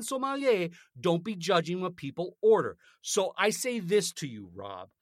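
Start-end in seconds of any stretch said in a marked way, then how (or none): none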